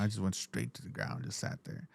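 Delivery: soft dad voice. Retold or imitated. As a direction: let it sink away to nothing. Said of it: "that little small tone".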